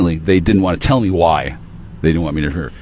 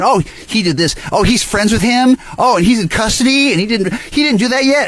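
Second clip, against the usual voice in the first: high-pitched mock whining; shrieking harpies; 12 semitones higher than usual. Two women freaking out over an absent guy, mock shrieking. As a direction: mimic fretful gossips in a shrill squeal. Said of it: "these shrieking harpies, whining and crying".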